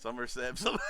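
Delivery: normal talking voice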